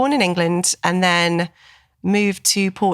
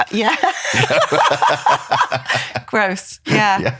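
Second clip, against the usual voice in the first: laughing; 3 semitones higher, much slower than usual. Real, not acted. Laughing about custard cakes.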